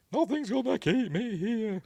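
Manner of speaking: high-pitched, hammed-up furious cry; acted rage. Pearl stabbing the projectionist, acted high-pitched rage. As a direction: shriek it in mock rage. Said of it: "kind of enraged, isn't she?".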